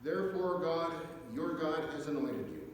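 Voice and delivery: ordinary delivery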